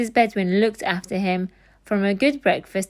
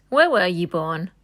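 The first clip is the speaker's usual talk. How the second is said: urgent question. A narrator's reading. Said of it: "he asked urgently".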